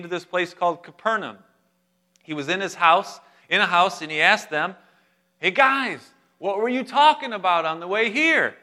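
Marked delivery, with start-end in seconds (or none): 5.4-8.6 high, loud acted question to the group; calling out. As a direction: hail them casually in a raised voice. Acted Jesus calling to disciples.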